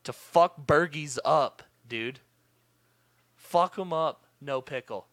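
loud, slow, outraged complaining; angry rant. Retold or imitated. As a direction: hammer each word in fury. Ranting about wrong pickles.

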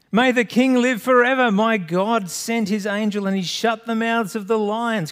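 high, loud reply from afar; calling back. Acted. Daniel answering the king from the den, calling back. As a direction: shout it up out of the den. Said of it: "Daniel says back from within the, the, the den".

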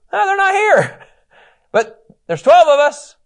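very high, loud squeaky voice; hammed-up squeaky brothers; comic brothers. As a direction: squeak out the excuse.

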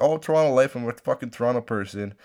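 high, loud mocking poser voice. Vlogger mocking suburbanites who pretend to be from Toronto, high mock voice. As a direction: ham up a fake Torontonian.

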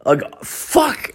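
high cracked mock panic; high startled curse; panicked realization. Real, not acted. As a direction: squeak out the dread.

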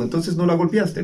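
questioning father. Retold or imitated. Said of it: "le digo".

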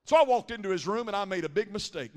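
usual speaking voice

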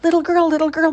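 high, loud coaxing voices; coaxing women. A narrator's reading. Village women coax the lost girl, high and sing-song. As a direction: call gently to the lost girl. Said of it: "they said".

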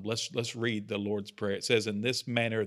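ordinary talk, nothing marked

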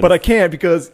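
high, loud mock apology; exaggerated hedging; reluctant excuse. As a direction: act out squirming out of sharing. Acted stoner refusing reluctantly.

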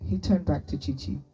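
ordinary delivery

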